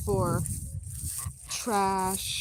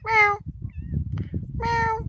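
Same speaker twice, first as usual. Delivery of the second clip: high, squeaky imitation meow; mock meow. Owner meowing back at her cat, high mock meow.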